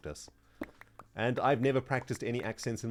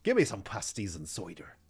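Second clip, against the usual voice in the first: rough attempt at Devon; Devon accent; 2 semitones lower than usual. Shown as a demonstration. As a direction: Devon yokel with a splash of Scottish. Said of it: "Try Devon?".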